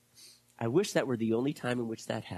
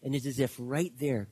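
ordinary talk, nothing marked

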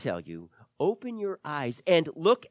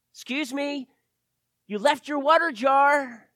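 high-pitched call, getting louder; calling after someone; 8 semitones higher, 5 dB louder than usual. Acted. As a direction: call out to someone who is walking off. Acting disciples calling after woman.

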